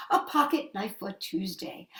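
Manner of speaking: ordinary delivery, nothing marked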